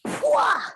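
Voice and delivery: high, loud slap noise; smack sound. Retold or imitated. Aunt acting out slapping her nephew, loud smack noise. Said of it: "I went".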